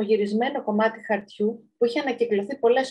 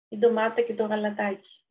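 usual speaking voice

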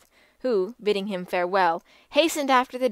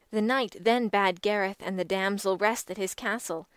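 usual speaking voice

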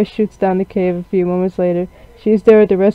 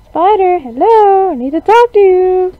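put-on high girl voice; 11 semitones higher, 3 dB louder than usual. Voicing character calling into cave.

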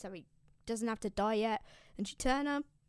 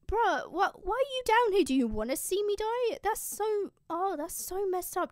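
whiny complaint; 10 semitones higher, 5 dB louder than usual. Acted. Turnus whining at his sister.

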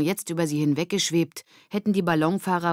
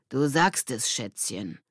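grumbled reply; 3 semitones lower, slower than usual. A narrator's reading. Mitch answering the others about the teacher, grumbling. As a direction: grumble it, dropping away on the last word. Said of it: "brummte Mitch".

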